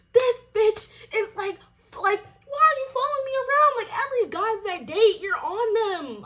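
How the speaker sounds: fed up, voice cracking high with anger; shrill outrage. Retold or imitated. Retold outrage at the girl.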